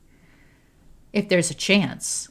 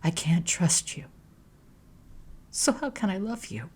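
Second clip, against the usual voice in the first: sadly; 5 dB quieter than usual. A narrator's reading. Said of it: "turns to her sadly".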